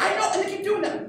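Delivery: high-pitched imitation of a frustrated coach; exasperated coach. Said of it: "And she's like".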